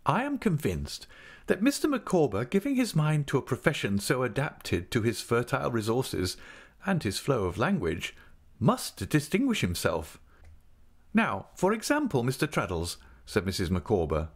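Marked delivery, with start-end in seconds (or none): 0.1-10.2 lightly raised, earnest matronly lady; a hint of a grand, fussing wife; Mrs Micawber voice. A narrator's reading. 11.1-13.0 lightly raised, earnest matronly lady; a hint of a grand, fussing wife; Mrs Micawber voice. A narrator's reading.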